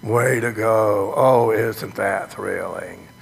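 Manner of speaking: low, slow, deadpan cheer; limp, unenthused fan; mock bored fan. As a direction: drone it like a bored spectator. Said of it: "Are you saying".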